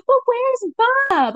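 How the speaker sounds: high, drawn-out imitation wail; exaggerated lovelorn imitation; mock pining wife. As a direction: whine it up high, mocking her concern. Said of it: "You're like".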